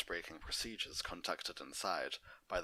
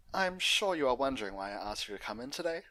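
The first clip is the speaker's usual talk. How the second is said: Doctor Jenny; 6 semitones higher, 6 dB louder, faster than usual. Acted.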